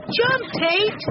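put-on very high, urgent boy's call; shouted warning. A narrator's reading. Benny warns Tate in the rocking boxcar, shouted warning. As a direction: call out high to save him. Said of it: "Benny shouted".

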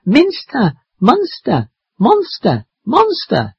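high, repeated calls, mildly yelled; yelling. Acted. Maddie calls for help in monster language, restrained yell. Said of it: "I yell".

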